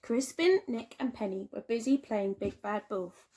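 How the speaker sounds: usual speaking voice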